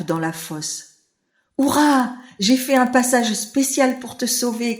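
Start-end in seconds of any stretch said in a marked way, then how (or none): 1.6-4.8 « Hurrah » aigu et fort, joyeux; cri de joie. A narrator's reading. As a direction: triomphe joyeux du sauveteur. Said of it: "crie le rat". (le rat crie de joie)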